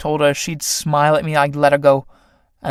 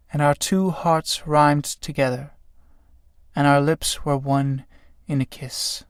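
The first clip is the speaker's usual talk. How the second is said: poem recital; 4 dB quieter than usual. Acted. Felix reads his poem aloud.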